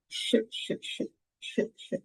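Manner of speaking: long voiceless shhh; hushed ch. Shown as a demonstration. Teacher hushing the ch sound for the class. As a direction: hush the ch sound, no voice. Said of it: "il y a le son".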